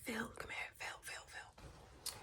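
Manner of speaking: hissed call. Retold or imitated. Calling husband over secretly at the party, soft and slow. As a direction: call him over in a hush.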